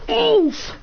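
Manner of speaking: high, sudden oof; pained oof. Acted. Character reacting to being one-shotted, pained oof. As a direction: yelp it high as if struck.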